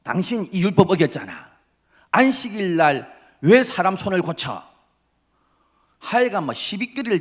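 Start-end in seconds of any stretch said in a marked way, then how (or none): 0.0-4.6 higher, louder fault-finding accusation; accusing Pharisee. Retold or imitated. Pharisee loudly accusing Jesus of breaking the Sabbath. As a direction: jab at him like a self-righteous Pharisee. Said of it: "눈에 막 레이저를 뿜습니다".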